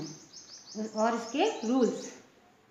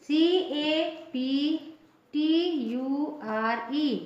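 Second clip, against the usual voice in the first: usual speaking voice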